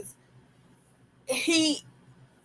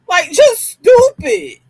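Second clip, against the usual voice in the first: high, slow, disgusted; scornful disbelief; 13 semitones higher, 6 dB louder, slower than usual. Real, not acted. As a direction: stretch it out in disbelief. Scornful exclamation.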